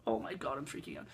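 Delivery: sighed out under the breath, very soft; low, voiceless groan of desperation; quietly falling apart, re-enacted; exasperated despair. Retold despair waiting for drinks.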